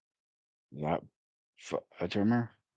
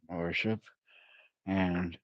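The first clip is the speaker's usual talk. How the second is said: normal talking voice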